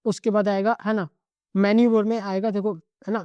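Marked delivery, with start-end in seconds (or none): none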